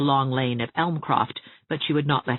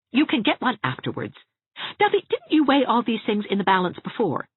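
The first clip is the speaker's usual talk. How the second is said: higher-pitched brisk rebuke; exasperated Anne, impatient; 9 semitones higher, 3 dB louder than usual. A narrator's reading. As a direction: brush the objection aside impatiently.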